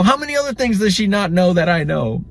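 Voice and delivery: hammed-up high voice defending himself to his brother; high mock kid voice. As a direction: pipe up in a cartoonish high voice. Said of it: "And I go".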